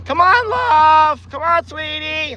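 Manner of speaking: high, sing-song voice calling the dog; sweet dog-talk. Real, not acted. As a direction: coax Stella over in a high pet voice. Coaxing dog onto ride.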